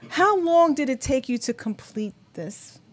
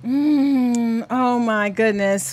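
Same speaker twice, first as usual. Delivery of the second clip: ordinary talk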